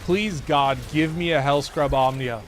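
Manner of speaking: usual speaking voice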